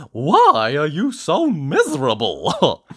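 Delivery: loud, high, slow cheery question; Mister Happy. A narrator's reading. Happy visitor questions his sad lookalike, loud and cheery. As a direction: ask it in a big happy cartoon voice. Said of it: "asked Mister Happy".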